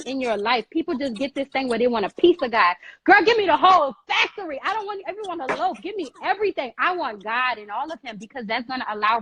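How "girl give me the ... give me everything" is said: 1 emphatic demand. Real, not acted. Emphatic preaching.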